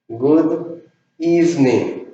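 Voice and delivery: evening as two syllables, slowly; slow English model. Shown as a demonstration. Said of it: "Esta palabra se pronuncia evening". (Good evening lento en dos sílabas)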